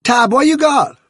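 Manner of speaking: high, loud shout down from the window; a girl shouting to her cat from upstairs; sharp shout. Acted. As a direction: call out sharply to the cat below. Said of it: "called Lucy sharply".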